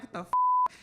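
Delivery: very high startled shriek; jumping at an intrusion; startled outburst. Retold or imitated. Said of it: "I'm like".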